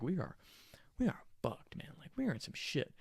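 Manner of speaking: high, soft retold whisper; hushed dread. Driver waits with friends while police check, hushed dread. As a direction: whisper it to your friends in disbelief. Doomed driver in silent car.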